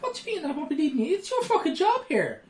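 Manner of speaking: high-pitched incredulous protest; mock indignation. Acting out a protest to a crewman who walks off, indignant. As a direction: act affronted, voice shooting up.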